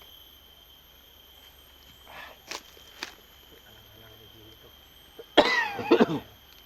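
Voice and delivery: a deep, real hacking cough; heavy coughing fit. Real, not acted. Coughing fit mid-vlog, friend notes the bad cough. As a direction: cough hard and long, chest heaving. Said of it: "grabing ubo".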